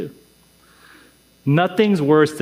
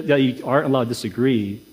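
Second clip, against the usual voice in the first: usual speaking voice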